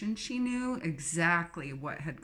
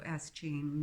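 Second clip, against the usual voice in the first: ordinary delivery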